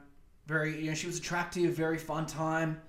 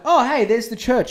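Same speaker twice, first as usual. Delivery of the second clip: eager spotting; 8 semitones higher, 11 dB louder than usual. Retold or imitated. Spotting a little church.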